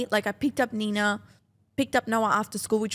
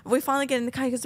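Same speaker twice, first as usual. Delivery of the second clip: usual speaking voice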